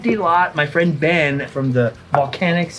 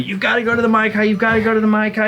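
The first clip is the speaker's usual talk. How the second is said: nagging friend; 7 semitones higher, much faster than usual. Retold or imitated. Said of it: "how many years have you been telling me".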